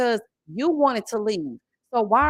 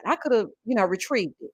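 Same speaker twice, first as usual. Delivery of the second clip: usual speaking voice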